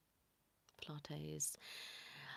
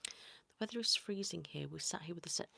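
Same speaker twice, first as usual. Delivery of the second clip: ordinary delivery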